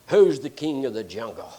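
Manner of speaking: low, booming mock lion roar. To the deer, the lion roars a low mock challenge. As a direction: growl it out like a proud lion. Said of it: "he roars real loud and says".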